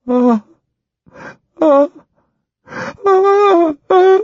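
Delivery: high, loud anguished ah cries; pathetic terrified cry. Acted. Ginger in trance, crying out in despair. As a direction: cry out in old terror. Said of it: "A pathetic cry escaped her".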